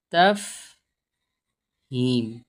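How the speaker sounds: तफहीम split into taf... heem, long gap between; very slow sounding-out. Shown as a demonstration. Teacher writing the word out afresh, very slow sounding-out. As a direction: say तफहीम syllable by syllable, drawn out.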